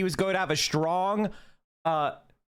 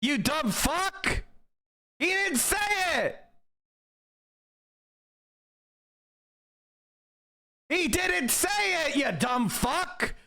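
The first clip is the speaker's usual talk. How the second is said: full-throated, much higher yell of outrage; furious shout; 10 semitones higher than usual. Real, not acted. Yelling at the TV panel, furious shout. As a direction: scream it at the screen.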